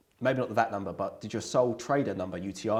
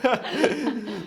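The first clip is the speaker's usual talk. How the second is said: laughing; 14 semitones higher, 9 dB louder than usual. Real, not acted. Host laughing at guest's offer.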